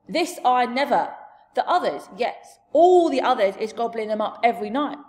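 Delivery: lightly voiced emphatic giant; a hint of a big giant voice; friendly giant, protesting. Giant protests, introduces himself.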